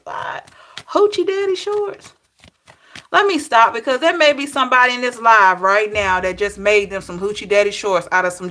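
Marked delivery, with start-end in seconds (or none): 0.0-2.1 high, incredulous What; shocked disbelief. Retold or imitated. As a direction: squeal the question in disbelief. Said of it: "I'm like".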